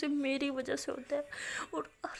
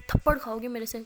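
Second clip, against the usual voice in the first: angry threat; 9 dB louder than usual. Acted. Rimsha threatens crying Maya.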